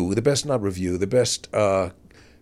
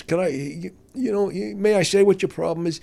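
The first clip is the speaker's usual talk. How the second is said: higher, mock-earnest; mock serious; 7 semitones higher than usual. Big shot about to critique the comic, mock earnest. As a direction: lean in like you're doing him a favour. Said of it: "with all seriousness".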